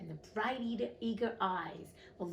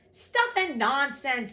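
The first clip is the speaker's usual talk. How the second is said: slow, loud, higher-pitched scoffing; loud protest; 5 semitones higher, 9 dB louder, slower than usual. A narrator's reading. Alice contradicting the queen in court, loudly. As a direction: dismiss it loudly, drawing the words out. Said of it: "said Alice loudly".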